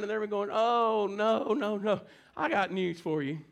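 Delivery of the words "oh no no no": drawn-out mock lament; half-hearted imitation of weeping; mock crying. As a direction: pretend to moan in dismay. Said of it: "they're gonna be crying".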